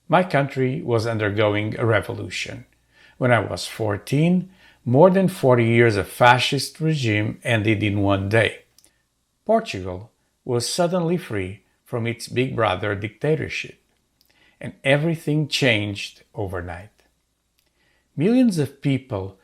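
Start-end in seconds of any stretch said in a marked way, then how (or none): none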